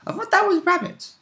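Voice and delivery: squeaky joking voice; high voice. Pretend. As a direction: pop up high in a silly put-on voice. Joking reply.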